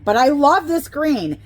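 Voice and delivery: real excited gush over a favourite plant; gushing delight. Real, not acted. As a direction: swoon over it like a mad woman. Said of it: "I know I sound like a mad woman".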